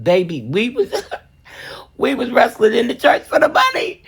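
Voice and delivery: high laughing retelling. Real, not acted. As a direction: laugh while telling it.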